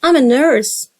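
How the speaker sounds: usual speaking voice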